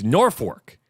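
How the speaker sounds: "Norfolk" said slowly as "Nor-fork"; Nebraska pronunciation. Shown as a demonstration. Host showing the Nebraska version, "Norfolk" as "Nor-fork". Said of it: "but it's spelled N O R F O L K".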